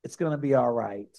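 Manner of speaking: low, soft reassurance; reassuring. Retold or imitated. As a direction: say it gently, like comforting a friend. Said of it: "a reassuring look".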